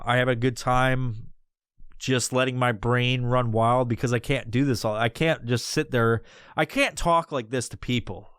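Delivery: usual speaking voice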